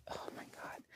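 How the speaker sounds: whispered in awe; breathless whisper. Real, not acted. Reader swooning over favourite book, awed whisper. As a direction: breathe the title like a secret treasure.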